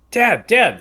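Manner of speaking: tug at dad with your voice; urgent kid calling. Retold or imitated. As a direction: kid urgently trying to warn his dad. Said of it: "And I'm like".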